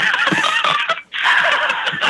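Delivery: high airy laugh. Real, not acted. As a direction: burst out in breathless laughter. Laughs at being called out.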